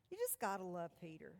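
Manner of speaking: ordinary delivery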